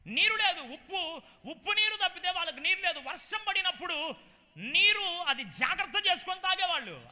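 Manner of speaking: loud, high, ringing preacher's cry; full-force preaching. Acted. Preacher exalting the man of God to the congregation, loud and high. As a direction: preach it out to the whole hall, rising high. Preaching about salt water.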